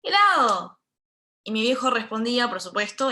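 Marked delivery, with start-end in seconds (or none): 0.0-0.8 loud, high call across the street; shouted greeting. Retold or imitated. Neighborhood doormen greeting the speaker's father, shouted. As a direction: holler it as a hearty hello. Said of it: "le gritaban".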